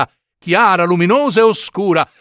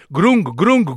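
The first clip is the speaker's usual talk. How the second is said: mock grunting; 2 semitones higher than usual. Imitating the nephews grunting.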